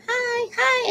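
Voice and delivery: very high put-on hi; acted high-pitched niceness; polite greeting. Re-enacts own greeting meanwhile.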